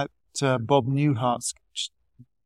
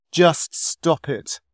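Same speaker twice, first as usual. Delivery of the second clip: slow, louder, drawn-out command; emphatic, deliberately slow punchline; blunt advice; 3 semitones higher, 5 dB louder, much slower than usual. Retold or imitated. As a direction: spell it out slowly and firmly, as the psychiatrist. Psychiatrist's blunt advice in sketch.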